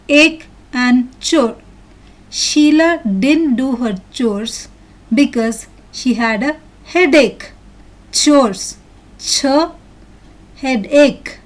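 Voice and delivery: slow and separated, "Ache" ending in "k", "chore" starting with "ch"; listen-and-repeat pronunciation. Shown as a demonstration. Teacher modelling sentences for children to repeat, slow careful delivery. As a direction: read "Chores", "ch" with careful pauses. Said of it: "Listen and repeat these words".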